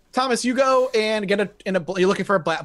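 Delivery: ordinary delivery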